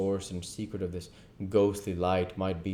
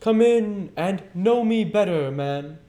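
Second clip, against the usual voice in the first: high, loud booming welcome; jolly giant ghost exclaiming; 12 semitones higher, 9 dB louder than usual. Acted. Ghost greets timid Scrooge at the door, high and loud. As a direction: call him in like a hearty giant. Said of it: "exclaimed the ghost".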